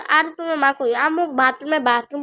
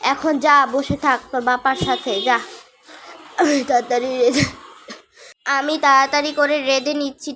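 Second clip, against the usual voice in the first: ordinary delivery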